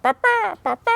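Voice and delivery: very high squeaky bird imitation; bird chatter. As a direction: chirp it like a flock of birds.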